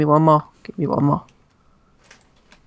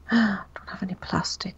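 whispered dismay; 8 dB quieter than usual. Real, not acted. Gasp then whispered worry.

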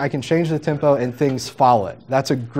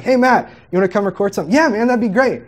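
higher and quick, breezy buddy banter; retold studio chat; 6 semitones higher, faster than usual. Asking friend Matt to come record, light quick banter. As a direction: toss it off like friends setting up a session. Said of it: "I'm like".

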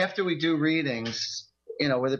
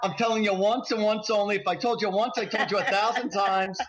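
higher, laughing, bossy parent lecture; a playful parent telling off the kids; mock scolding; 6 semitones higher than usual. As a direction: nag them like a fed-up parent, grinning.

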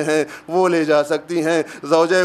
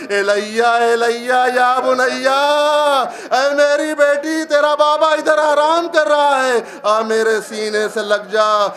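wailing lament; 7 semitones higher than usual. Acted. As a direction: cry it out as a grieving father's voice. Father's voice calls from body.

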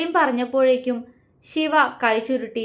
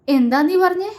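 louder, higher, stunned question; shocked demand; 8 semitones higher, 5 dB louder than usual. A narrator's reading. Victim learning who hurt his brother, shocked. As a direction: burst out in disbelief.

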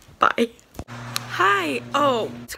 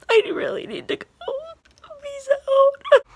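crying; 6 dB louder than usual. Real, not acted. Signing off while still crying.

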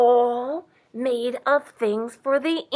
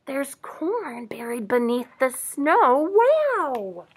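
amazed; 3 semitones higher, slower than usual. Real, not acted. Reader marvels with children at buried corn, amazed. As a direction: marvel slowly, stretching the last word.

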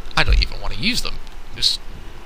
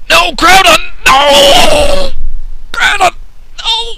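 shrieking far higher and much louder; mock anguished scream; 10 dB louder, much slower than usual. Commentator screaming as Groudon crashes, mock anguish. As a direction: scream it like your hero just fell.

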